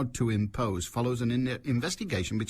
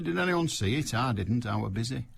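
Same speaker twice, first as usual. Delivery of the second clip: ordinary talk, nothing marked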